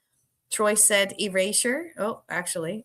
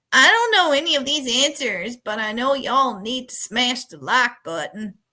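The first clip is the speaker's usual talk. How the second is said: louder, higher put-on drawling accent reading a viewer comment; 5 semitones higher, 5 dB louder than usual. Reading Dave's comment aloud in a loud put-on accent. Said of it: "I'm gonna try that accent again".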